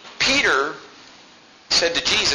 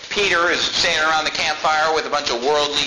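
ordinary delivery

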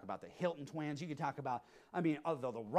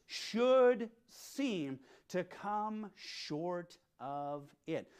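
should seem dragged out very slowly; slow emphasis; much slower than usual. Shown as a demonstration. Preacher stressing key words to his flock, very slow. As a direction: lean on "should seem to come short", word by word. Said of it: "don't miss these next two words".

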